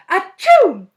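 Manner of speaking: explosive high, loud sneeze; huge sneeze. A narrator's reading. Teacher sneezes his nose off, huge sneeze. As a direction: sneeze with full force. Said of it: "Mister Gorf sneezed so hard".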